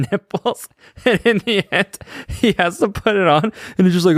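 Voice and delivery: high voice breaking into laughter; laughing while talking. Real, not acted. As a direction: tell it through real giggles. Cracking up recalling film scene.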